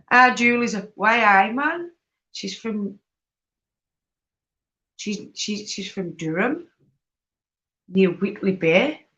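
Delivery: badly attempted Geordie lilt with rising phrase endings; bad Geordie accent. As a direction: put on a clumsy Geordie accent. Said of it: "that is the worst Geordie accent I could have done".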